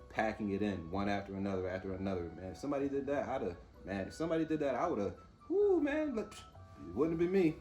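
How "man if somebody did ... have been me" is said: high, excited bragging, rising to a yelp; boastful bravado. Retold or imitated. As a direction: hype yourself up like a tough guy. Imitating a boastful viewer.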